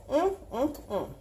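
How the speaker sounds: high pleased hum; savoring hum. Real, not acted. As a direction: yum hum over the food. Savoring imagined bread.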